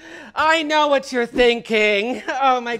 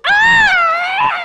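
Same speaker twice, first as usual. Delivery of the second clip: mock shriek; 7 dB louder than usual. Mock reaction to American pronunciation.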